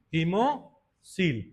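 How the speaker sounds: "hemocoel" stretched very slowly in two parts; slow, split repetition. Real, not acted. Teacher answers own quiz for students, "hemocoel" slow and split. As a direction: say "hemocoel" drawn out, long pause before coel. Term repeated after quizzing the class.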